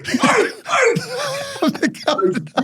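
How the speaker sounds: high-pitched yelling, hammed up; laughing high mock shout. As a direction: squeal it out like a big guy caught in a headlock. Big bloke yelling in headlock.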